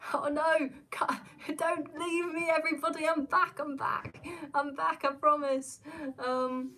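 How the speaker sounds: real panic turning to relief after the stream crash; flustered return. Real, not acted. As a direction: rush back in, begging them to stay.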